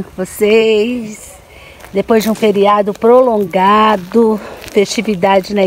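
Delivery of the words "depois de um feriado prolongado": normal talking voice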